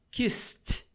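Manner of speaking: kissed said loud as "kist"; one-syllable kissed ending in T. Shown as a demonstration. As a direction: punch kissed, hissed S into a sharp T. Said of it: "vai pronunciar como T".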